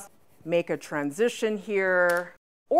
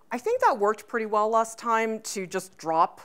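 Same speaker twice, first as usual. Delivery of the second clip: ordinary talk, nothing marked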